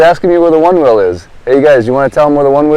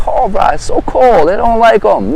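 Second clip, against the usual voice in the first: whiny tourist; 10 semitones higher than usual. Retold or imitated. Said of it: "Don't ever get stuck and like".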